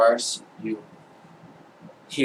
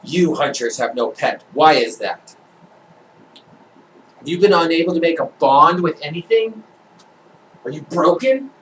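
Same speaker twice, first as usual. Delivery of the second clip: judge's pointed questioning, lifting at the end; lightly voiced demanding judge; interrogating judge; 5 semitones higher, 8 dB louder than usual. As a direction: question them as the judge, increasingly incredulous.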